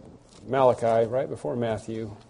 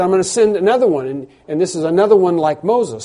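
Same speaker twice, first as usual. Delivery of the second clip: louder, higher, fast proclamation; voicing Moses; 5 semitones higher, 7 dB louder than usual. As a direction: announce it with urgency.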